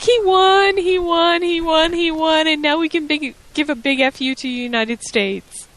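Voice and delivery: high-pitched mock celebration, easing down; giddy squeal of victory, played up; overjoyed. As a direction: imitate a wrestler's manager gushing with joy. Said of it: "beside herself with joy".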